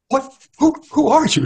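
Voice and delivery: put-on high, startled cry of a blind old beggar; a stranger appears and the beggar starts in shock; shocked and alarmed. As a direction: recoil in alarm, voice jumping up high. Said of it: "said the man, shocked, drawing back in alarm".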